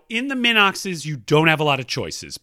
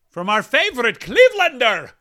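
hammed-up announcer; 6 semitones higher, 6 dB louder than usual. Host introducing a favorite emailer, hammed-up announcer. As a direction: belt out the listener's nickname like a ring announcer. Announcing a listener like a hype man.

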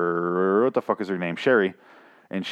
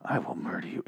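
low, much softer, fast menace; hushed threat with a knife at his throat; quick hushed warning; quiet threat; 4 semitones lower, 11 dB quieter than usual. Retold or imitated. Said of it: "He's like".

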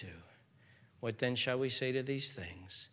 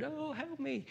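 a hint of a desperate plea, soft but higher; mock desperate cry; 5 dB quieter than usual. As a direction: lightly imitate a lost man crying out. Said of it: "a desperate cry of".

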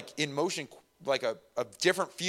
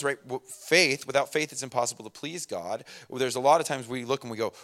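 normal talking voice

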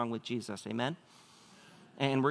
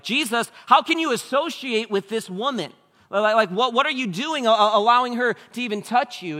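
much higher and louder, scandalized protest; indignant religious leaders; 9 semitones higher, 8 dB louder than usual. Retold or imitated. Pharisees protesting to Jesus about the woman, high and scandalized. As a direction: sputter at him in outrage. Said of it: "religious leaders are like".

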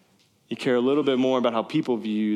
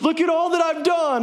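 much higher, louder bragging; boastful Saul; 4 dB louder than usual. Retold or imitated. Saul showing off his monument, high and boastful. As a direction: crow about your own work.

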